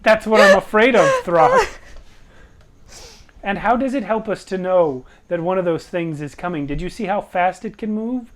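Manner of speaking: high, loud worried protest; anxious party member protesting. Acted. Player voices anxious character arguing.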